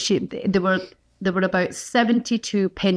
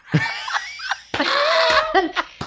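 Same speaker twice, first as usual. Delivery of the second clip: big laugh. Real, not acted. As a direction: burst into a wheezing laugh. Laughing at the retold scene.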